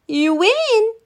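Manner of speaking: put-on high, loud cheer of a kid; shouted. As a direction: call out the win with joy. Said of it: "shouted Danny".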